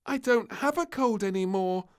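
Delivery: put-on high, loud child announcement; announcing. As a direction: declare it proudly. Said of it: "announced the little princess".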